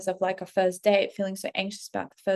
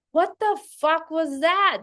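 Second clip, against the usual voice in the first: baffled; 7 semitones higher, slower than usual. Retold or imitated. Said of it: "But honestly, like".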